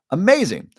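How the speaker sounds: a sudden high, loud burst of delight; amazing, jumping up high and loud; excited. Real, not acted. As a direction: let the word leap up with real excitement. Repeats praise of mushroom study.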